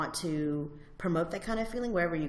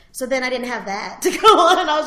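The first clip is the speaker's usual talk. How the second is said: laughing; 5 semitones higher, 13 dB louder than usual. Real, not acted. Laughing at her loneliness.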